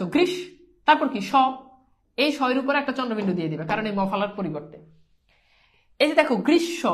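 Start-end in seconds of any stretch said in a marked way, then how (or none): 0.0-0.3 "গ্রীষ" held on its final sh, then a stop; stopped syllable. Shown as a demonstration. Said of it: "এখানে থেমে যাচ্ছ". 6.5-6.8 "গ্রীষ্ম" split as grish-sho; doubled consonant. Shown as a demonstration. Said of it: "দুইবার উচ্চারণ হয়েছে".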